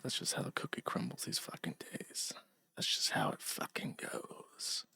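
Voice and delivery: soft resigned whisper; a quiet, rueful whisper to himself. Real, not acted. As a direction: breathe it out under the breath. Muttering aside after stream ends.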